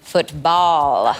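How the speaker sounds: slow, drawn-out "Football"; imitated laid-back talker. Given a topic, imitating him with a drawn-out delivery. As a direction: lean back and stretch the word. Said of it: "Give me a topic".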